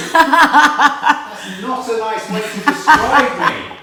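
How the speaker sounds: high, loud mock-offended laugh; playful outrage, laughing; mock indignant. Real, not acted.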